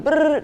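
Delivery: high, loud lip-trill beat noise; trilled brrr. Retold or imitated. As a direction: buzz the lips like a drum roll. Beat sound between name ideas.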